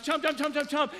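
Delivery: high, rapid-fire chewing noises; mock munching sound effect; imitated chomping. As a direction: chomp out each bite playfully. Mimics making son chew the food.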